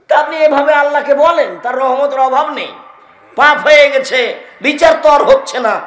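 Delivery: high, loud fiery sermon; preaching at full force. Acted. (উচ্চস্বরে জোরালো ওয়াজ)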